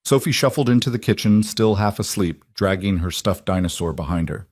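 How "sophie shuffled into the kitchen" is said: plain reading voice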